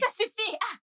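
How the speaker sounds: high, sharp cut-off; angry. Acted. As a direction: shut him down, fed up. Cuts off the apology.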